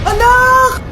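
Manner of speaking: high loud wail; anguished cry. Acted. Mother crying out on hearing her child died, anguished scream. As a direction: scream in grief.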